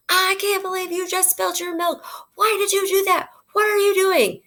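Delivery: put-on high, sharp scolding of a child over spilled milk; angry parent scolding. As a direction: snap at the kid like an upset parent. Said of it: "You're using an angry tone".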